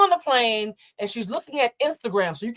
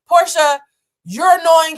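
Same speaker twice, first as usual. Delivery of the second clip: exasperated scolding; 6 semitones higher, 10 dB louder, slower than usual. Real, not acted. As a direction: call her name out louder and higher. Scolding Portia through the screen.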